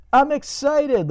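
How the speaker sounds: high, loud, stretched-out mock squeal of words; hammed-up excitement. Telling the audience about the coming chase, hammed-up excitement.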